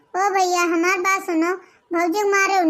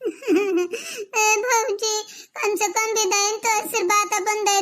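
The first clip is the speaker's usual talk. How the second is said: tearful laugh-cry; 5 semitones higher, slower than usual. Acted. Sister's tearful farewell to sister-in-law.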